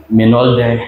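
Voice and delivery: normal talking voice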